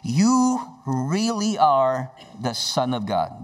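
slow, drawn-out declaration; exclaiming. Retold or imitated. Disciples worshipping Jesus, slow exclamation. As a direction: draw it out in solemn exclamation. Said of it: "they exclaimed".

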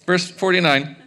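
usual speaking voice

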